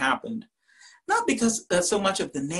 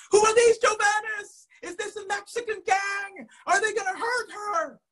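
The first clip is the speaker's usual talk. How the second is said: very high, excited mock alarm; dramatic professor. Professor whipping up suspense for the class, high dramatic cry. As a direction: cry out the questions at a very high pitch.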